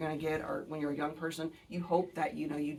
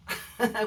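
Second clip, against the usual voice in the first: short laugh; 6 semitones higher, 5 dB louder than usual. Real, not acted. Laughs at professor's remark.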